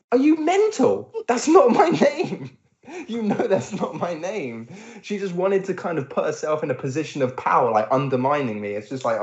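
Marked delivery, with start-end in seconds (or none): 0.1-4.7 high, slow, disbelieving outburst; incredulous retort. Retold or imitated. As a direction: throw it back at her, pitched high and slow in disbelief. Indignant retort to being misnamed.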